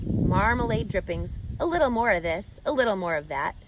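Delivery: ordinary delivery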